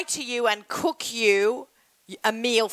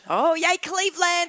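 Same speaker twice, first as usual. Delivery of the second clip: excited; 8 semitones higher than usual. Real, not acted. Cheering for Cleveland listeners.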